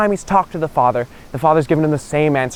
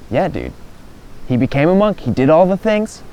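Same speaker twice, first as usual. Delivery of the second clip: ordinary delivery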